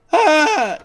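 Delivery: sudden high yelp of alarm; a knight recoiling at the monster, crying out in shock; startled knight yelp. Acted. Knight seeing the clawing monster, high startled cry.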